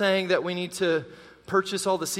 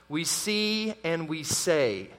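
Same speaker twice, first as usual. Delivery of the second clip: slow emphatic summary; 2 semitones lower, 3 dB quieter, much slower than usual. Acted.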